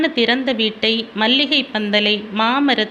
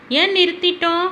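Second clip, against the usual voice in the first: put-on curious child; 7 semitones higher, faster than usual. Child questions father in story.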